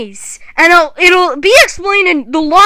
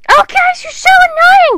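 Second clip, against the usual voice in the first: whiny complaint; 12 semitones higher than usual. Acted. Character whining at friends.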